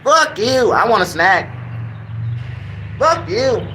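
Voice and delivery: high parrot voice asking for a snack; parrot begging. Retold or imitated. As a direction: squawk it like a greedy parrot. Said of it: "just to try to get a snack".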